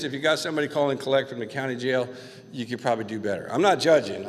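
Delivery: ordinary delivery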